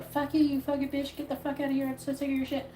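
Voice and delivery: imitating what he'd like to yell at her; angry rant. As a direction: let it all spill out at her. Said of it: "on the verge of going".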